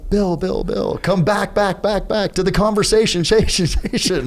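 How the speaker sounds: high, repeating echo effect; imitated echoing voice. How the mentor sounded to a dazed listener, imitated echo. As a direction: call out high, repeating words like a far-off echo. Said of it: "and it sounded like this".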